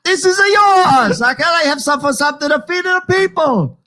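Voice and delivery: very high, wailing cartoon Italian; hammed-up Italian parent outcry; mock Italian accent. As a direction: lament in a bad Italian accent. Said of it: "why we can't do the accent".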